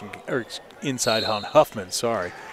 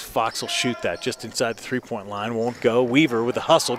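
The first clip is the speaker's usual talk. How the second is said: ordinary talk, nothing marked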